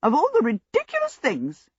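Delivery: high, scornful scolding; no-nonsense princess, contemptuous; utter contempt. A narrator's reading. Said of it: "looked at the two djinns with utter contempt".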